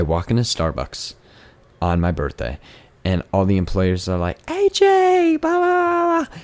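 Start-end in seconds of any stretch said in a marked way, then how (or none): 4.5-6.4 very high, sing-song mock greeting; squealy employee greeting. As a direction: squeal it like excited cashiers. Imitates employees greeting her.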